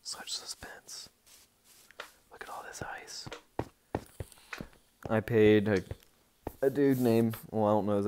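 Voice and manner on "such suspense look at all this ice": whispering. Acted. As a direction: breathe it like a secret. Building suspense on tour.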